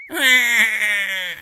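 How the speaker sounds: put-on high child laughing; toddler giggle. George laughing after his roar, high giggle. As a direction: giggle delightedly.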